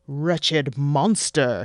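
slow, loud, grand hero voice; hammy hero declaiming at the monster; pompous hero. Acted. Marduk booming grandly at the monster. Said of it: "he said".

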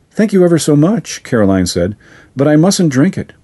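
put-on high girl's voice, politely firm; a little lady saying no nicely; girl declining the host's brew, polite. A narrator's reading. Caroline voiced by narrator.

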